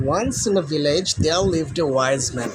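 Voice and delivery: slow, each word spaced out; slow story reading. Shown as a demonstration. Teacher reads the story opening to the class. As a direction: read the story line slowly for pupils. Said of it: "দেখি গল্পটি কী রয়েছে".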